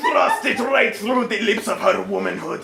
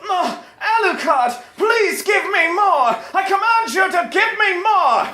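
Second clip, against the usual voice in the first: much higher, louder, commanding mock cry; hammed-up high demand for more; Integra crying out; 11 semitones higher, 3 dB louder than usual. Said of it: "She began to softly cry out".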